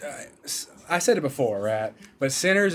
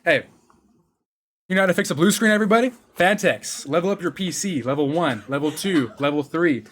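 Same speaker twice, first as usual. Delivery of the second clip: loud hyped sponsor read; 3 semitones higher, 5 dB louder than usual. Acted. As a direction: sell it like a hype announcer.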